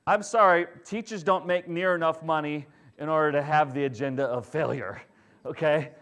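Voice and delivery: ordinary talk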